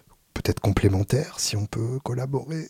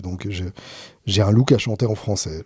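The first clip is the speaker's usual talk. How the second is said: ordinary delivery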